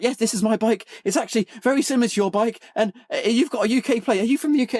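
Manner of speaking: high, eager mock shout; Charlie, retold shouting. Charlie greets fellow bikers about their plates, high mock shouting. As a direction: imitate someone shouting keenly, pitched well up. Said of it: "he just starts shouting at us".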